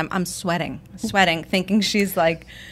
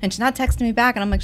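normal talking voice

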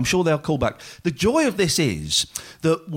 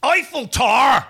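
loud, high, drawn-out Northern Irish shout; Northern Irish accent; 8 semitones higher, 4 dB louder than usual. Retold or imitated. Host answers the Northern Irish challenge, in a loud hammed-up Northern Irish accent. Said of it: "Northern Irish".

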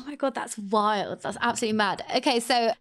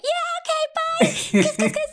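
very high, squealed mock sign-off; hammed-up high-pitched farewell with kisses; gushy sign-off; slower than usual. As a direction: act out an over-friendly goodbye way up high. Acting out own gushy email sign-off.

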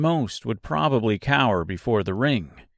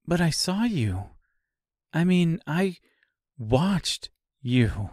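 stunned disbelief; 5 semitones higher, slower than usual. Acted. Dorothy stunned by living scarecrow.

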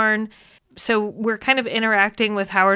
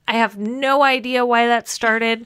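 normal talking voice

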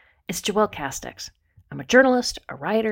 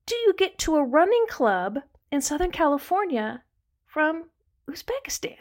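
much higher, wide-eyed disbelief; amazed question; 9 semitones higher than usual. Retold or imitated. Host to running partner, amazed, pitched way up in disbelief. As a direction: ask it like you can't believe your ears. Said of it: "I was like".

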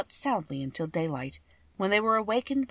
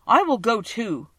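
loud, higher-pitched character; Scarecrow; 5 semitones higher, 7 dB louder than usual. A narrator's reading. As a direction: declare it loudly and high. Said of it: "declared the Scarecrow".

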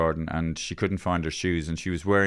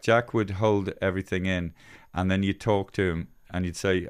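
ordinary delivery

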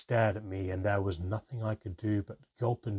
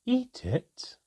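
high amused question; a touch of melodic amusement; melodious laugh; 4 dB louder than usual. A narrator's reading. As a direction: laugh at the idea. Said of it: "Manka laughed melodiously".

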